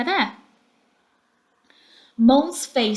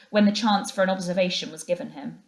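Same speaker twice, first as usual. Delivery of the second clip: usual speaking voice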